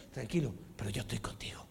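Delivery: fast, whispered reassurance; soft whispered comfort. Acted. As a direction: hush him gently, quickly. God comforting the man, voiced.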